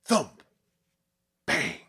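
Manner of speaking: sharp loud thump then bang; loud imitated banging; loud banging noises. A narrator's reading. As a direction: bark out the knocks like blows on a door. Banging at night outside.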